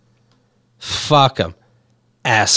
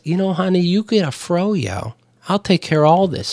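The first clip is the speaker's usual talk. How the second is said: mock sweet husband; 5 dB quieter than usual. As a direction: coo it like a smug husband. Husband voiced sweetly to wife.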